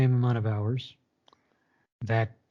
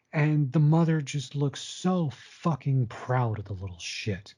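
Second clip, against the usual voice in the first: ordinary talk, nothing marked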